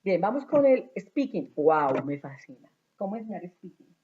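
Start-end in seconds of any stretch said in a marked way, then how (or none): 1.5-2.0 a loud, delighted wow; excited wow. Real, not acted. Teacher to colleagues about speaking, loud delighted wow. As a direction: burst out with delight.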